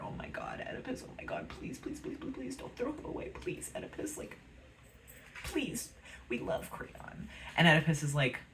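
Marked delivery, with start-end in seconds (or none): 0.0-7.3 breathless whispered begging; a hushed chorus begging the king; pleading whisper. Acted. Chorus begging Oedipus to spare Creon.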